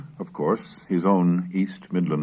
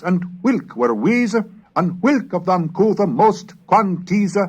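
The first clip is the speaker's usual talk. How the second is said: put-on higher, louder declaiming of old rhyming verse; verse recitation; 12 semitones higher, 6 dB louder than usual. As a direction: proclaim it like a minstrel addressing his lords. Declaims Manning's Middle English verse.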